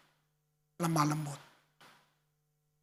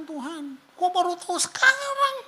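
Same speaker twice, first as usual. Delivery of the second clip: astonished; 8 semitones higher than usual. Retold or imitated. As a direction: voice leaping high on "kok baru tahu sekarang". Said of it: "saya bilang". (terheran-heran, suara melonjak tinggi)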